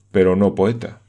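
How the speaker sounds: ordinary delivery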